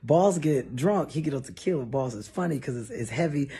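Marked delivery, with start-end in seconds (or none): none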